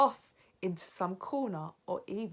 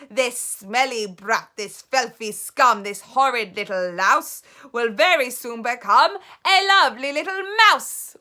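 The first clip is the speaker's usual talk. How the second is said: gloating spell-like chant; gleeful witch shout; 5 semitones higher, 15 dB louder than usual. Acted. Grand High Witch shouting a rhyme over Bruno. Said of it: "Then she shouted out".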